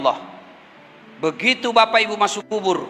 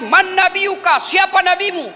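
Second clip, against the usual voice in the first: lightly acted questioning angel, pitched up; malaikat bertanya; 6 semitones higher than usual. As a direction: put a touch of stern interrogation into it. (malaikat menanyai dengan nada agak tinggi)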